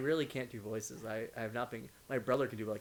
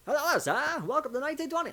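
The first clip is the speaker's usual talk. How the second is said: much higher, loud salesman greeting; 14 semitones higher, 8 dB louder than usual. Shown as a demonstration. Showing salesman warm-up, high loud greeting. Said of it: "with the salesman voice".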